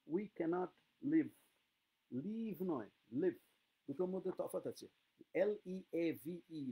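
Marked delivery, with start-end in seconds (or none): 0.1-1.3 live said slowly with a short i; careful pronunciation. Shown as a demonstration. Teacher showing students how to pronounce live. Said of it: "তোমরা কিন্তু উচ্চারণটা বলবে". 5.3-6.7 spelling aloud. Shown as a demonstration. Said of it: "লিভ মানে ছেড়ে দেওয়া".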